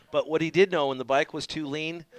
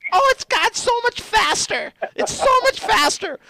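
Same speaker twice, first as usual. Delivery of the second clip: high, slow, dopey voice of a reckless rider; mock him in a high, carefree drawl; imitating Scott; 8 dB louder than usual. Mimicking his old boss Scott, high and carefree. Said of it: "He goes".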